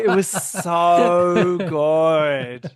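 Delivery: slow, loud hammed-up satisfaction; drawn-out mock. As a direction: stretch every word lazily. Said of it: "what did she say?".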